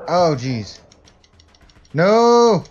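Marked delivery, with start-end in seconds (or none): 1.9-2.6 loud alarmed no; shout. Real, not acted. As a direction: yell it out in alarm. Dog attacked, yells.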